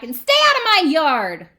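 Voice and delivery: angry, high, very loud yell; Hank. Acted. Cactus ordering the jackrabbit away, yelled. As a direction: yell it to drive them off. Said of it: "Hank yelled back".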